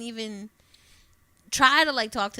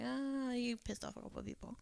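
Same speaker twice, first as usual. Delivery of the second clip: retold reluctant answer; 12 dB quieter than usual. Friend to hungover pal who forgot last night, soft reluctant answer. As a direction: break it to him softly, as an aside. Retelling morning-after answer, much softer.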